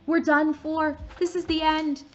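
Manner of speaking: high, loud hopeless wail; despairing wail. Acted. Ron wailing at the locked door, high and loud. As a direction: cry out that all is lost. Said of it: "Ron moaned".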